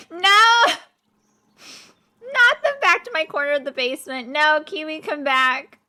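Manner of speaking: loud, high, fast pleading; pleading shout. Real, not acted. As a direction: call after them in panic. Pleading as Kiwi leaves.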